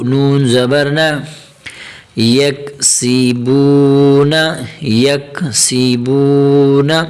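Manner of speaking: slow, drawn-out syllable chant; teacher reciting slowly for learners to follow; slow Quranic spelling recitation. Shown as a demonstration. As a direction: stretch each long vowel evenly as you spell it out.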